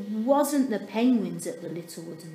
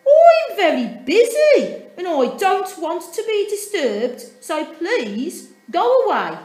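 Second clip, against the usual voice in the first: high, much louder, firm refusal; cross Dudley; 9 semitones higher, 13 dB louder than usual. A narrator's reading. Dudley turns his sisters away, loud and cross. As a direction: slam the no at them.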